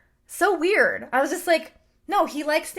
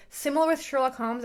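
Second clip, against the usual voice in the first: normal talking voice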